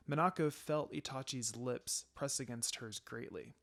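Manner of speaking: usual speaking voice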